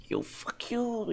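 high, slow mock boss voice; mock boss line. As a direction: ham up the boss taunt. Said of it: "he says".

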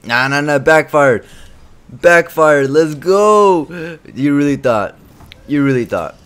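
high, loud, gleeful taunting; gloating cheer. Real, not acted. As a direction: crow over the win. Celebrating opponent's backfire.

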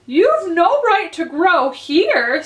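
high, slow, indignant squeak; Dormouse voice. A narrator's reading. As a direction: scold like a cross dormouse. Dormouse scolds Alice.

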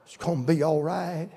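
soft, gentle comfort; tender reassurance. Retold or imitated. Church comforting its pastor of forty years, tenderly. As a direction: soothe quietly.

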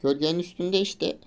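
real gamer frustration, shouted; frustrated shout. Real, not acted. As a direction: bark at yourself to just catch it. Failing to catch fish.

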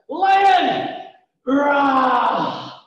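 high shouted lion roar. Pretend. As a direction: roar it out loud like a lion for the kids. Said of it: "Even louder".

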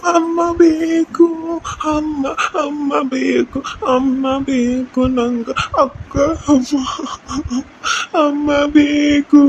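slow, sobbing plea for mother; crying child. Acted. Little orphan boy sobbing to his sister for mother. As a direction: wail for amma through tears.

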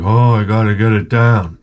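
deadpan like a resigned janitor; Ron's voice. Retold or imitated. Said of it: "He's like".

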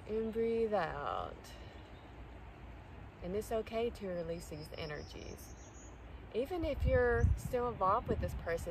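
soft, unhurried meditation voice with long pauses; guided meditation. Real, not acted. Guiding listeners to release someone's energy, soft calm meditation voice. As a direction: guide them gently through each breath.

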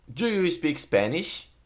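very slow, each word laid out clearly; slowed English model sentence with a rising end; slow teaching model. Shown as a demonstration. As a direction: say it slowly so a learner can copy it.

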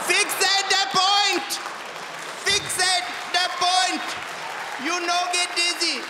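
very high, stretched-out shouted command; high mock shout. Comedian explaining the forehead dot as dizziness cure, high mock shout. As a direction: call it out slowly at the top of the range.

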